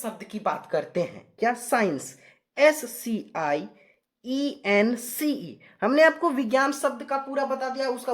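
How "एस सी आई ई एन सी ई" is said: slow, drawn-out letter-by-letter spelling. Real, not acted. Teacher spelling Science for students, slow spelling out. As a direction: spell it slowly, each letter stretched and separate.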